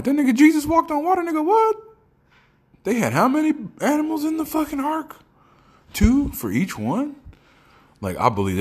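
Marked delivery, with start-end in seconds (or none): 0.0-7.1 very high-pitched incredulous questions; gullible kid. Retold or imitated. Mocking his younger self believing everything, high amazed kid voice. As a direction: squeak it out like an amazed, credulous child.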